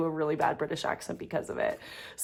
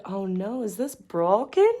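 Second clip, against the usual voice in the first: put-on terrible British accent; 8 semitones higher, slower than usual. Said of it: "I was rambling about a British accent".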